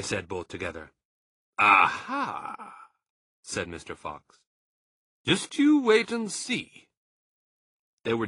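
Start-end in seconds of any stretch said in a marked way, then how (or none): 1.6-2.9 loud sly exclamation; a triumphant aha; knowing aha. A narrator's reading. Mr Fox teasing his son and Badger, sly aha. 5.2-6.9 high, loud and slow teasing; teasing fox. A narrator's reading. Mr Fox keeping his plan secret, slow teasing.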